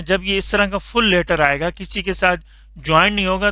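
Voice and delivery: ordinary talk, nothing marked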